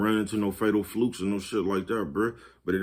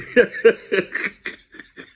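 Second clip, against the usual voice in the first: a sudden high cackling laugh at his own joke; real laugh at his own joke; loud high burst of laughter; 13 semitones higher, 8 dB louder than usual. Real, not acted. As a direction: crack yourself up and let the laugh burst out high. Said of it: "I cracked myself up".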